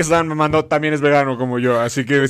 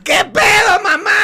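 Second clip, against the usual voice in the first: high, louder mock yell; 7 dB louder, slower than usual. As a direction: shout to mom across the party. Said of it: "le grita algo así como". (hijo grita a su mamá que se cayó, grito fingido)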